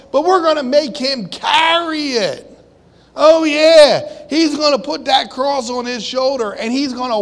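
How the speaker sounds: high, loud mocking shout; gloating mob yelling up high; jeering mob.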